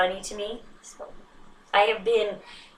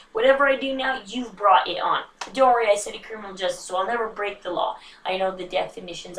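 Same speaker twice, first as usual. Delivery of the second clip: usual speaking voice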